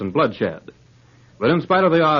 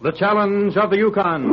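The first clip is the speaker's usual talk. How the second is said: big radio title announcement, higher; announcer; 8 semitones higher than usual. Acted. Announcer opening the radio drama. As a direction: proclaim the show title.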